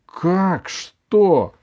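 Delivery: high, loud flash of temper; flaring up. A narrator's reading. Grandmother to grandson who asks what he did wrong, flaring up. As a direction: flare up at the question. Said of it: "вспылила бабушка".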